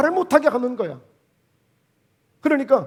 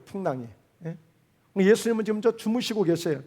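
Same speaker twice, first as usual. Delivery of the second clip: ordinary delivery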